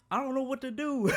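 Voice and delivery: high, loud imitation breaking into laughter; mock panicked helplessness. Lost friend on the highway phoning for help, high mock panic. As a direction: mimic a friend at a total loss.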